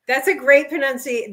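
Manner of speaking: ordinary delivery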